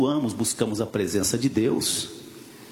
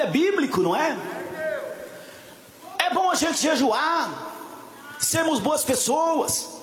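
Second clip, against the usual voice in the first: full-force preaching; 13 semitones higher than usual. Acted. Preacher rousing the church about Samson's strength, full-force preaching. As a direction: preach it at full volume, pressing on without letup.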